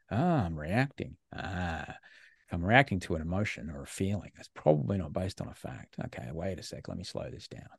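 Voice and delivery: hushed, reflective talking to oneself; calming self-talk. Acted. Man coaches himself through reacting to a feeling, quiet and reflective. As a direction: murmur it softly, as if realising and easing off.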